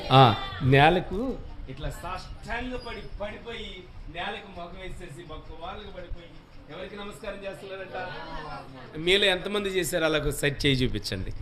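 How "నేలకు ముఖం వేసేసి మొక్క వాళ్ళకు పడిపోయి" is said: usual speaking voice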